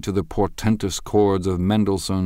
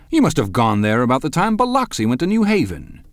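higher and louder, openly mocking; incredulous and insulting; 8 semitones higher, 6 dB louder than usual. A narrator's reading. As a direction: sneer at him in disbelief. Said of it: "incredulous and insulting".

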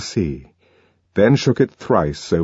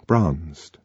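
ordinary talk

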